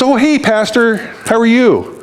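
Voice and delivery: high-pitched friendly neighbour hail; high, chirpy imitation of a neighbour; neighbour's greeting. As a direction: lift it high, calling a casual hello. Voicing the cheerful neighbour greeting.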